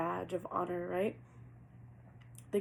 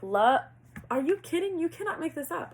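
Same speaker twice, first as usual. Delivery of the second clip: much higher and louder, real shock; stunned disbelief; 9 semitones higher, 9 dB louder than usual. Real, not acted. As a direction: blurt it out, amazed at the reading. Reacting to a shocking card reading.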